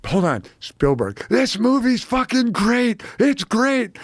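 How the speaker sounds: mock-thrilled studio boss on the phone; Ron Meyer thrilled. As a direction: rave about the movie like a giddy mogul. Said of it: "Ron Meyer gets the phone".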